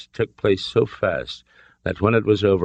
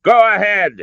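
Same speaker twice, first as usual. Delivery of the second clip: high, loud dare; defiant shout; 8 dB louder than usual. A narrator's reading. Father dares the colonel to shoot, defiant shout. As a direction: call his bluff at full voice. Henry defies the colonel's threat.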